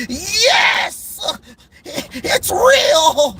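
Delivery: high, cracking mock cheer of a rival fan; mock gleeful fan. Vlogger mocking rival fans, high-pitched mock cheer. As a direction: gloat like a fan who just got the news.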